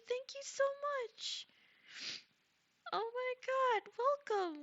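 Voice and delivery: very high, slow, squeaky gush; overjoyed squeal; squealing thanks. Real, not acted. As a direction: squeal it like you can't contain yourself.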